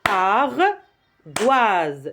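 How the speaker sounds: ARDOISE split into ar... doise; syllable by syllable. Shown as a demonstration. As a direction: hold "ar", pause, then "doise" in ardoise. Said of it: "on va taper les syllabes".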